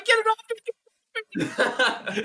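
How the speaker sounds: high, shrieking mock terror; mock panicked shriek. John Stamos shrieking at the comic on his leg, mock panic. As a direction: squeal as if something clings to your leg. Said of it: "he's freaking out, going".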